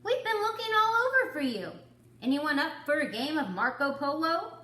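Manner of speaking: high, loud and fast; excited calling. Acted. Pig calling the others to play, high and loud. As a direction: rush the words, eager to play. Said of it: "called Pig".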